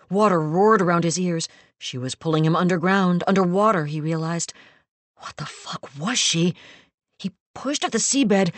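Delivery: ordinary delivery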